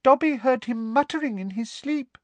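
high squeaky house elf. Acted. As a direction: squeak it timidly. Elf voice continues.

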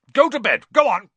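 high, loud angry order; Uncle Vernon shouting. A narrator's reading. Uncle ordering Harry to bed, high and loud. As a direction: bark him off to bed.

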